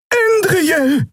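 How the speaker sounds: very high-pitched cry of a name; desperate call. A narrator's reading. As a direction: call out to warn a friend. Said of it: "rief Xea". (hoher, verzweifelter Ruf)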